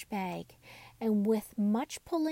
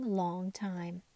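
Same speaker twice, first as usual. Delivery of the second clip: ordinary delivery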